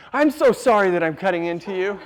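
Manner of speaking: hammed-up sorrow of a too-sensitive surgeon; oversensitive surgeon. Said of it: "highly sensitive person to cut into you".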